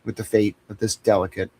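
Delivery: mock solemn. Mock-solemn farewell to the listeners. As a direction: hand over the bird like a sacred duty.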